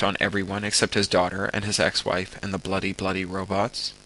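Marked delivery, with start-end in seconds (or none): none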